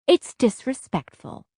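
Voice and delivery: mild finger-wagging reproof; snapped scolding. A narrator's reading. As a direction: scold like a mother laying down rules. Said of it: "snapped in her finger waving in my face tone".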